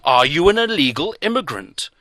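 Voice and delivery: loud, insistent repeat of the question; pressing. A narrator's reading. Doctor pressing closer, loud insistent demand. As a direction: lean in and demand an answer. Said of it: "He pressed closer".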